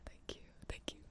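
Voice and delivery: soft ASMR whisper; breathy close-mic whisper. Real, not acted. ASMR charity stream whisper.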